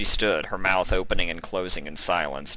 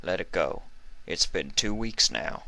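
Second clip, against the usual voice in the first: pleading; 5 dB quieter than usual. Acted. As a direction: coax her to let it go. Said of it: "adopting a pleading expression".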